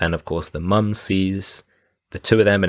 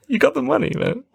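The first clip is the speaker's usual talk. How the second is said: high squeaky protest; indignant plea. Retold or imitated. Danny DeVito protesting to the robber. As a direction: protest in disbelief.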